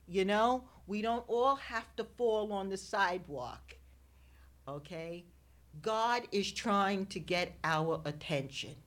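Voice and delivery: usual speaking voice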